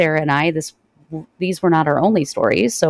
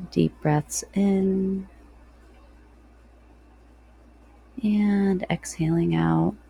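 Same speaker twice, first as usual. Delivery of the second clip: guided meditation. Real, not acted.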